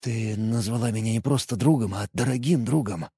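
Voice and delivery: soft, hushed voice; whispered. Acted. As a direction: say it softly, almost whispering. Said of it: "прошептал".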